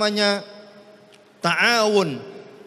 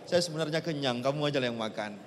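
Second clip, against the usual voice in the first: much softer and lower, offering food; soft selfless fib; 6 semitones lower, 12 dB quieter than usual. Retold or imitated. Hungry man telling his friend to eat first, softly. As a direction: quietly brush it off so the friend eats.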